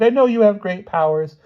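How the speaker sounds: high, loud frightened plea; desperate high-pitched pleading; begging. A narrator's reading. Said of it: "she begged".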